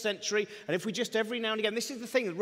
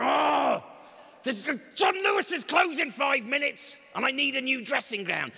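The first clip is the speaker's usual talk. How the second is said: high, loud, hammed-up panic about a dressing gown; frantic mock shout; 5 semitones higher, 3 dB louder than usual. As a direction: yell like a harried shopper who is running out of time. Frantic shopper complaining.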